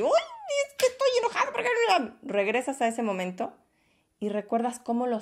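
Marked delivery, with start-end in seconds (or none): 0.0-2.2 much higher, laughing mock complaint; mock angry whine. Imitating a viewer's relapse into anger, high and laughing. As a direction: toss it off as a playful high whine. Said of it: "vas a decir".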